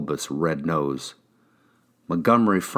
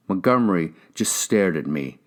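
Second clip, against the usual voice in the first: ordinary delivery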